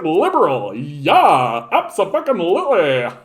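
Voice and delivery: lightly mock a blind party loyalist; a hint of a dim cheerleader; mock clueless voter. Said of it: "You're just like".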